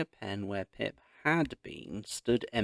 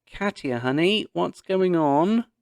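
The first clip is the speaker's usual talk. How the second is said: high, loud call from another floor; calling from upstairs; 9 semitones higher, 8 dB louder than usual. A narrator's reading. Mum calling down from upstairs, loud and high. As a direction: call down the stairs to her daughter. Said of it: "Mum's voice floated down from upstairs".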